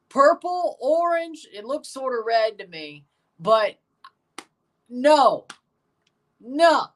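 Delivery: high, loud disbelief; outraged. Real, not acted. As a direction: exclaim it in horror. Outraged at jersey colours.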